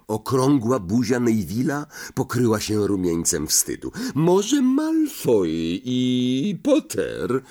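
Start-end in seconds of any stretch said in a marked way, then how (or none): 4.1-7.4 slow, drawn-out sly question; sly suggestion. A narrator's reading. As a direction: ask it with a crafty smile. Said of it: "zapytał z chytrym uśmiechem".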